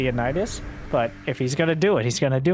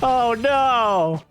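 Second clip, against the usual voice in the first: mock dismay; 6 semitones higher than usual. Real, not acted. Caster reacts to walled-in stalkers.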